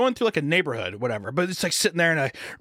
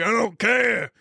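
Vin Diesel creep; 4 semitones higher than usual. Retold or imitated. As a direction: shout it like a sleazy flirt. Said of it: "he's like".

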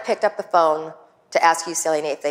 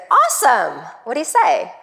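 high, louder burst of eagerness; excited; 7 semitones higher, 4 dB louder than usual. Retold or imitated. Wife retelling her reply to husband's news, excited. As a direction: re-enact an eager reply, pitch jumping up.

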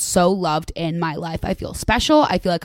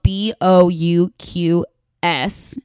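slow spelling; much slower than usual. Shown as a demonstration. Host reading the sponsor's name letter by letter, very slowly. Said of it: "I had to read that really slowly".

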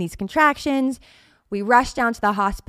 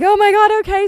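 retold panic; 12 semitones higher, 8 dB louder than usual. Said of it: "I'm like".

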